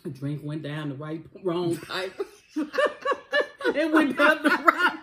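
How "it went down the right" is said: high laughing repeat. Real, not acted. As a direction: laugh through the words.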